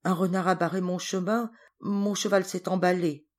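ordinary delivery

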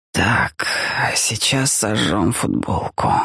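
put-on low, slow tired old man. A narrator's reading. Helmut voiced in the story.

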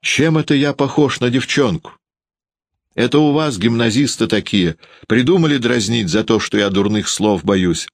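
put-on a boy's voice rising with injured pride; ringing voice. A narrator's reading. Sasha, angry at his own shyness, answering in a ringing voice. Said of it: "спросил зазвеневшим голосом".

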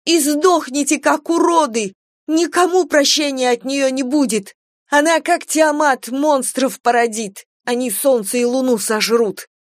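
high, loud screaming curse; hysterical screaming. Acted. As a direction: shriek the prophecy in tearful fury. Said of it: "прокричала Алиса".

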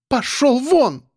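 very high-pitched angry dismissal; shrieky woman sending him away; petulant. A narrator's reading. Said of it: "повторила Элла". (капризно, на высокой ноте)

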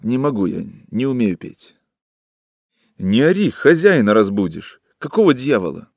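plain reading voice

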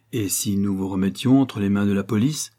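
ordinary delivery, nothing marked

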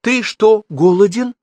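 high, loud, cutting question; shouted question. A narrator's reading. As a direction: throw the question out sharply. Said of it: "выкрикивая всякие вопросы".

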